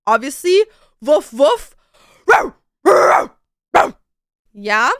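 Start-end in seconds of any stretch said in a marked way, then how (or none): 1.0-1.7 dog bark. Acted. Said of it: "I can do dog". 2.3-3.9 high loud yowling row row; snarling angry cat. Acted. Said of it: "I can do an angry cat".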